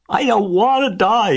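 very high, loud tearful wail; moaning. A narrator's reading. Pig bursts into tears, high loud moan. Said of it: "he moaned".

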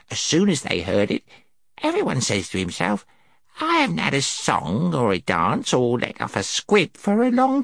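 put-on gnome voice with wide pitch swoops; gnome storyteller. A narrator's reading. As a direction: tell your tale as an excitable little creature.

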